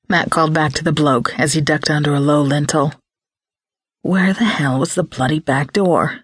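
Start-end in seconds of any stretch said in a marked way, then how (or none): none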